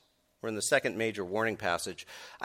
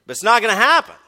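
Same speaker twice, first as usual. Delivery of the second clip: loud, high, hammering each word home; emphatic preaching; 10 semitones higher, 11 dB louder than usual. Real, not acted. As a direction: preach it with full conviction. Preacher rising to full force.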